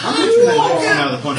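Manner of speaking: laughing mock yell.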